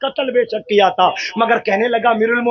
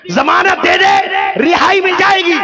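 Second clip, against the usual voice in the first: higher, louder pronouncement of the ruler; commanding ruling; 7 semitones higher, 5 dB louder than usual. Acted. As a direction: declare the verdict with force. Caliph's ruling voiced.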